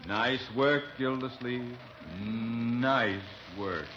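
dry, sinking mock compliment; sarcastic praise. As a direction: congratulate him with weary scorn. Rope and pick just lost.

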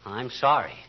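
husband softly apologizes to his teary wife; tender apology. Acted. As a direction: soothe her gently, sheepish. Husband comforts crying wife.

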